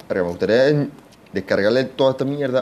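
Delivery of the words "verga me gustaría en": usual speaking voice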